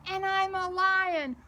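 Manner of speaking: loud playful announcement; big proud lion declaration; excited roar. Acted.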